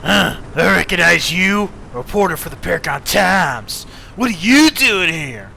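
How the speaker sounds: gang member voice. Acted.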